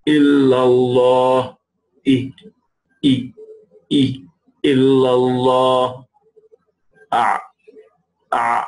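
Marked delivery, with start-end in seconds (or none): 0.1-1.6 "illallah" very slow, long doubled l; drawn-out "illallah"; stretched illallah. Shown as a demonstration. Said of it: "the ha". 4.7-6.1 "illallah" very slow, long doubled l; drawn-out "illallah"; stretched illallah. Shown as a demonstration. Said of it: "the ha".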